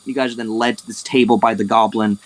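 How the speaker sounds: ordinary talk, nothing marked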